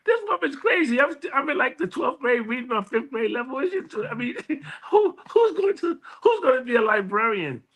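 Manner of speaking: high, laughing disbelief at the very idea; incredulous, laughing. Retold or imitated. Teen scoffing at mom's librarian prediction, high laughing disbelief. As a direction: scoff like it's the craziest thing you've heard. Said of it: "I said to myself".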